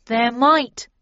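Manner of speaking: loud, slow speculation; Snell's voice. A narrator's reading. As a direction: say it slowly and emphatically. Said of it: "continued Snell".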